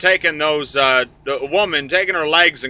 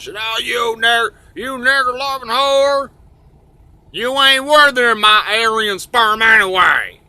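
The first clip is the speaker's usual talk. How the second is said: higher-pitched mock bigot tirade; neo-Nazi guy; 9 semitones higher, 3 dB louder than usual. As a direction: rant as a hammed-up bigot. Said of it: "there's the neo-Nazi guy that says".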